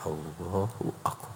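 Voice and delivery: reverent breathy whisper; very soft, whispered takbir; hushed prayer opening. Acted. Voicing the entry into prayer.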